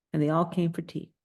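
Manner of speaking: plain reading voice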